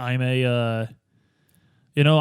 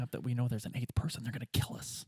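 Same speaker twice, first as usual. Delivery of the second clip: near-whisper, much softer; hushed aside; 3 semitones lower, 14 dB quieter than usual. Real, not acted. Player whispering worry to the GM, near-whisper. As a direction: drop to a breath so the table barely hears. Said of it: "out-of-character worry, hushed".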